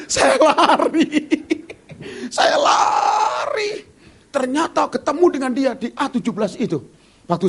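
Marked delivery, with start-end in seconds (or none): 0.1-1.3 slow, loud, laughing through it; laughing run. Retold or imitated. Pastor retelling his run to the lost man, slow and laughing. 2.3-3.8 high, crying shout; mock panicked cry. Pastor retelling his frantic run to the lost man, high crying shout.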